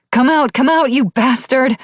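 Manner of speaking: high, loud frantic yell at the dark; wild yell. A narrator's reading. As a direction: scream it out wildly into the house. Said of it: "and yelled wildly".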